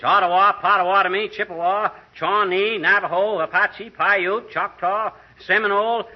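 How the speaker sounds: show-off roll call of names; loud boastful listing. Acted. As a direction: shout the tribes like winning a bet. Rattling off tribes to win argument.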